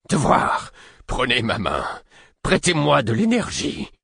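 voice cracking. Acted. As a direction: cry out as the voice splinters. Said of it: "La voix d'Imbre se fêla quand il s'exclama". (voix qui se fêle dans une exclamation)

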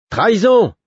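high, forceful yell; howled alarm. A narrator's reading. Cobb bursting into the room, howling treason. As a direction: yell it out in outrage. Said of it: "hurla-t-il".

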